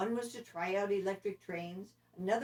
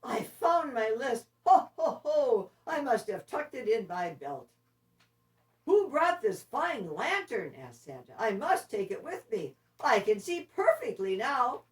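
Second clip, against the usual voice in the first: higher, louder ho-ho-ing Santa; merry and booming; jolly Santa; 5 semitones higher, 7 dB louder than usual. Acted. Santa finds his list and laughs, louder and jolly. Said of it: "suddenly jolly again".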